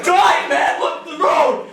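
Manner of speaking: high-pitched panicked yell, played for laughs; screaming. Storyteller re-enacting himself as terrified passenger yelling at the driver, mock screaming. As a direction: scream it at a reckless driver, acted. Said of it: "I'm start screaming".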